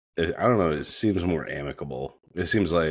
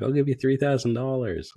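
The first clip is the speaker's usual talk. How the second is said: put-on whiny girl voice; 9 semitones higher, 3 dB louder than usual. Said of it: "And she's like".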